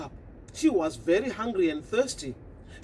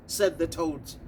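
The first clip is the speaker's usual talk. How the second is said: plain reading voice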